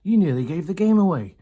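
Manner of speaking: high, loud scolding squeal; shrieking rat. A narrator's reading. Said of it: "Rat stood high on his shelf and shrieked".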